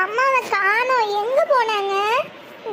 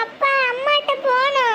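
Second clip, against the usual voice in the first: put-on crying child; 3 semitones higher than usual. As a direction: sob out the wish to see mum.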